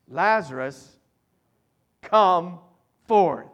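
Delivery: loud, firm command, forth ringing out; commanding call. Pretend. Preacher voices Jesus calling Lazarus with loud command. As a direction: order the dead man out of the tomb. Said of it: "It's a command".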